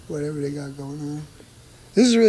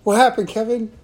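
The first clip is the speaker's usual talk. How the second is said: calling out; 7 semitones higher, 7 dB louder than usual. Real, not acted. Calling out to neighbor.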